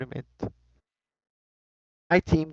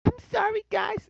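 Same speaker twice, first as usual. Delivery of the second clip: put-on much higher, small timid girlish apology; girl's voice. Rod finally speaks on the open mic, in a much higher girlish voice. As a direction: pitch way up into a shy girl's voice.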